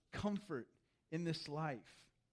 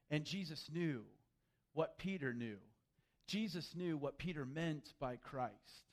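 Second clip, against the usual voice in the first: ordinary talk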